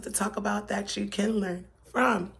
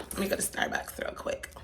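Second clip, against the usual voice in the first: whispered aside; 9 dB quieter than usual. Real, not acted. Aside while on the move.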